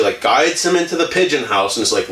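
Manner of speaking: ordinary delivery, nothing marked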